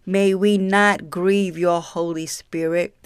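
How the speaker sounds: soft, low earnest prayer. Real, not acted. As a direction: pray quietly and humbly. Closing prayer.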